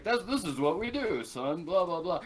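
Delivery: a hint of a dad teaching his kid; father bird. Father bird teaching a kid bird, lightly acted fatherly voice. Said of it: "like a father bird teaching a kid bird".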